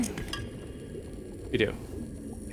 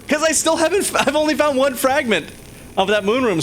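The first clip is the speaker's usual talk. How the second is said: excited; 9 semitones higher than usual. Real, not acted. Excited complaint, big pitch jump.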